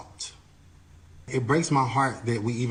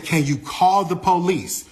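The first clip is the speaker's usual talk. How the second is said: urgent plea; 5 semitones higher, 5 dB louder than usual. Retold or imitated.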